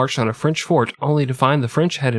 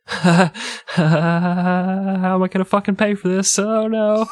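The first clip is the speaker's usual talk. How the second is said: mock panic; 8 semitones higher than usual. Broke friend panicking inwardly.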